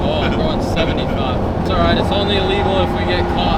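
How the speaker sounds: excited. Real, not acted. Speeding, teasing the instructor.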